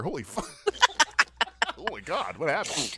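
normal talking voice

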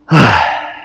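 long, exasperated sigh; weary sigh. Real, not acted. Remembering the doctor's question, a long fed-up sigh. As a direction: let out a tired, fed-up sigh. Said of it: "Just remembering that story".

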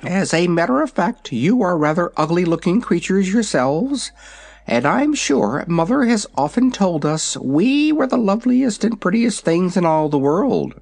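high, proud little dragon; young dragon voice. Acted. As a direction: talk like a vain baby dragon. Dragonette speaks.

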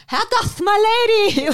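high, slow lisp on doth; lisping Leo impression. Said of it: "the lisp happening of the word doth".

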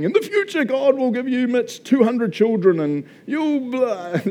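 very high, drawn-out mock prophecy; hammed-up wacky fortune-teller proclamation; crazy prophet. Crazy prophet coming up to people, high mock pronouncement. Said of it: "this crazy coming up to people".

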